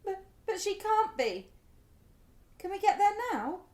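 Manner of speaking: put-on a mother hearing her child is hurt; panicked disbelief. Mother hears daughter is hurt.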